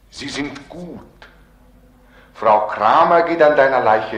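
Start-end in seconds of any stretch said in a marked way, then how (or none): 2.3-4.2 louder, declaiming with force; loud call. Acted. The Other confronts Beckmann, loud and declaiming. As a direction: hurl the name out like a challenge.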